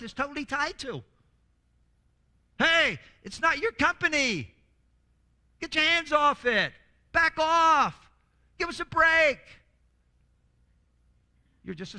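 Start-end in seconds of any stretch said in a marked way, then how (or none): 2.6-9.4 loud, slow, high scolding shout; angry rebuke. Acted. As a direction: tell them to back off, hard and loud. Preacher scolding controlling boss, shouting.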